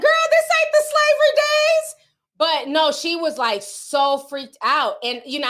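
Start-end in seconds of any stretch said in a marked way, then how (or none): 0.0-1.9 high, loud, squealing disbelief at the warning; mock disbelief. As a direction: shriek it like it can't be real. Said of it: "I'm like".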